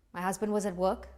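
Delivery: usual speaking voice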